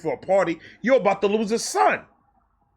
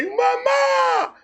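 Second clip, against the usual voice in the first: very high, stretched call of Mama; a kid's wail for mom; mock cry for mama. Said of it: "I didn't say".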